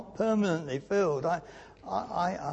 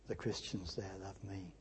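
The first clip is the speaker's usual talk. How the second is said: tender whisper; 9 semitones lower, 17 dB quieter than usual. Real, not acted. Preacher confiding to the congregation that his islanders love him, whispered. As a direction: whisper it with quiet fondness. Tender remark on Shetland flock.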